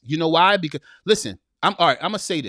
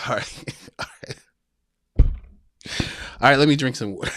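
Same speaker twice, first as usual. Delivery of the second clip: ordinary talk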